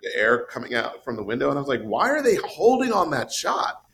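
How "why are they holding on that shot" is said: high-pitched baffled question; incredulous, pitch jumping well up; puzzled disbelief. Retold or imitated. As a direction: replay your bewilderment at the screen. Retelling puzzled reaction while watching film.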